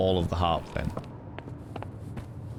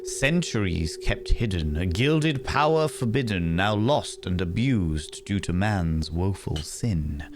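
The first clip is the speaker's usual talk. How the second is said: dramatic, higher-pitched storybook reading; theatrical story narration; 3 semitones higher than usual. Acted. Player reads out chapter five of collected stories, theatrical narration. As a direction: read it like a portentous rhyming tale.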